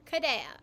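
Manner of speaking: "[name]" said as "ku-de-a"; "[name]" in three syllables, "ku-de-a", falling; Japanese pronunciation. Shown as a demonstration. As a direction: say "[name]" with a tapped R for the L. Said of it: "this is how Japanese people pronounce my name".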